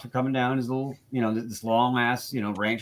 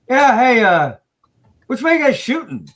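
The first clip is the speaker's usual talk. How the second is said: imitating the cop, higher and louder; retold cop; 10 semitones higher, 9 dB louder than usual. Cop asking the shooters their direction, mock voiced higher and louder. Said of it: "He goes".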